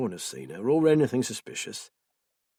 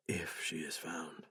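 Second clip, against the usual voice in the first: slow, much softer unvoiced whisper; 7 semitones lower, 16 dB quieter, slower than usual. Will doubting to himself in a slow whisper. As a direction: breathe it to yourself, slow and hushed. Will's grim private thought.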